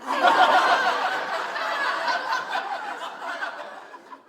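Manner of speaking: light breathy laugh; a quick amused laugh. Real, not acted. Laughs after the ad bit.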